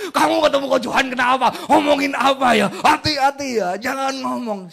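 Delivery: loud, warning parent voice; wary Chinese parent. Retold or imitated. Chinese parent warning child about talking to Coach Johan, loud. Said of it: "Biasanya budaya Chinese".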